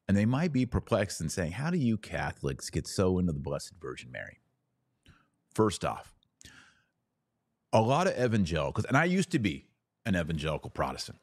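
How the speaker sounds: ordinary talk, nothing marked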